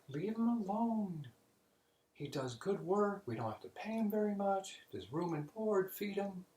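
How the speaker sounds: squeaky little cardinal begging the Pope; high-pitched cardinal. Acted. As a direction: play the cardinal as a high, eager pleader. Cardinal voiced in joke.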